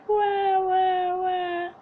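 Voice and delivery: mock baby wail; fake crying wah. A narrator's reading. Lost bunny wailing to herself. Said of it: "she started to cry".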